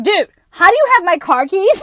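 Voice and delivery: shocked yell. Retold or imitated. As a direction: yell it in disbelief at the stranger. Shocked at stranger holding keys.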